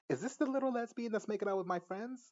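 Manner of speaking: high mocking mean-girl voice; catty girl voice. Recapping a show, voicing the bitchy girl high.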